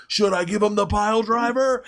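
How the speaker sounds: high hammed-up wrestler taunt; Hulk Hogan. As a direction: ham it up like a wrestler to the crowd. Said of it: "working the crowd like Hulk Hogan".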